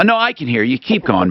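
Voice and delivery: ordinary talk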